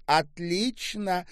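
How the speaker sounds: high, drawn-out cry; happy shout. A narrator's reading. Swimmer calls back from the pool, shouting. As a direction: call it out slowly across the water. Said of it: "закричала Ольга".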